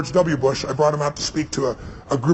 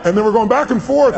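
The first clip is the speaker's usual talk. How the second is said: laughing; 6 semitones higher, 4 dB louder than usual. Real, not acted. Teller laughing at Gorbachev's line.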